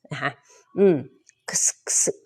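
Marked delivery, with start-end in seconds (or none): 1.5-2.2 "X" as a quick k plus hissed s. Shown as a demonstration. Teacher joins the two sounds of X, sounded out. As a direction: run k and s together for "X". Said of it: "X เขาจะมีสองเสียงนะคะ".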